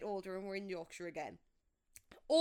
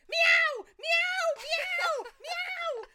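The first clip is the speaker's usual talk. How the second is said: very high, loud shrieked meows; shouting meow like a cat; 11 dB louder, much slower than usual. Retold or imitated. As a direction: yowl like a panicked cat. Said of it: "Like a cat".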